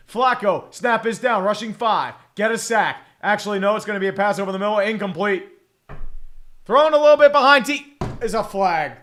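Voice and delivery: energetic football commentary; live play-by-play. Acted. Streamer calling a Bengals drive, live play-by-play. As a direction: call the play as it unfolds.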